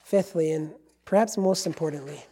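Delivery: ordinary talk, nothing marked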